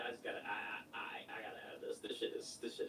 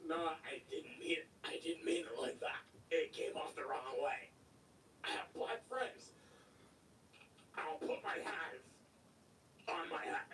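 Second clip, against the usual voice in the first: backpedalling; 6 semitones lower than usual. Retold or imitated. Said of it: "when they really get pressed".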